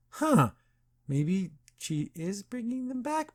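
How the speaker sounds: high, soft, slow musing; pondering aloud. Retold or imitated. Agent looks back and sees all the masks, wondering. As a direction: let the doubt dawn slowly, high and hushed. Voicing Chase's dawning realization.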